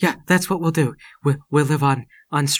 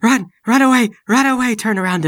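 high, loud yell to flee; terrified cry to get away; panicked shout; 10 semitones higher, 8 dB louder than usual. Acted. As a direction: scream at everyone to run. Fleeing the glowing stones.

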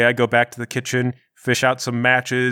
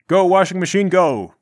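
eager cheer; 6 semitones higher, 4 dB louder than usual. Real, not acted. Player cheering on the machine.

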